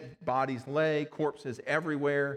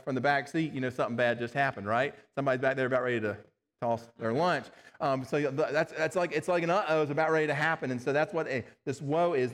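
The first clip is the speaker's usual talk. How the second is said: ordinary talk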